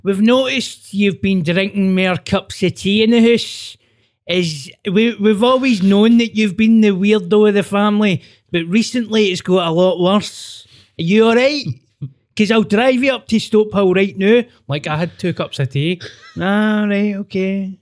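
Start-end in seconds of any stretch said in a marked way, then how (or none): 0.0-14.4 higher, louder put-on dad voice; mock concerned dad. Son mimicking his dad's worried chat, high and hammed-up. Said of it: "My dad". 16.4-17.8 mock concerned dad. Son mimicking his dad's worried chat, high and hammed-up. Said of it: "My dad".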